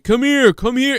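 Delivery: high, mock come here; pushy reporter. Reporter calling the girlfriend over, high mock voice. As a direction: beckon her, mocking the reporter. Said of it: "And he's like".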